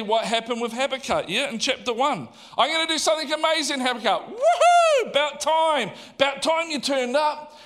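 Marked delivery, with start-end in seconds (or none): 2.6-4.3 high, fast, thrilled promise; excited announcement. Acted. God promising Habakkuk something amazing, excited announcement. 4.3-7.6 high, loud whoop of celebration; hammed-up delight, whooping and shouting; excited cheer. Habakkuk cheering God for finally turning up, excited.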